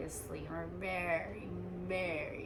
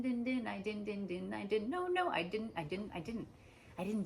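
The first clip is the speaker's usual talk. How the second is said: put-on sing-song childish bickering, running into stuttered repeats; loud mock squabble spiralling into babble, easing at the end; super defensive; 3 semitones higher, 4 dB louder than usual. Two defensive people endlessly contradicting each other, super defensive. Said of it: "you're super defensive".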